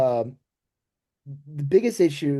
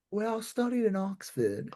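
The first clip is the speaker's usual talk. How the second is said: put-on soft, higher British accent; British off-camera voice; 5 semitones higher, 8 dB quieter than usual. Actor behind the scenes, British accent. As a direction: drop into refined British, quietly. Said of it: "behind the scenes, they're like".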